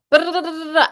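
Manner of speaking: high, loud mock babble of foreign speech; mock gibberish. To the confused foreigner, a high mock babble. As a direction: imitate people talking too fast to follow. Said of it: "they're like".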